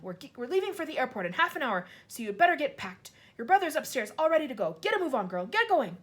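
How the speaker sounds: loud, high, hurried gruff dad; a father ordering his girl about; impatient father. A narrator's reading.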